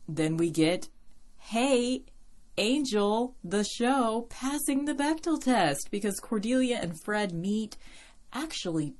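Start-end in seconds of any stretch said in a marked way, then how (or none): 1.4-7.8 high, bubbly burst of excitement; excited. Real, not acted. Podcaster celebrating the show passing the Bechdel test, excited. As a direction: cheer it on with rising pitch. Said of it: "I got excited for a second".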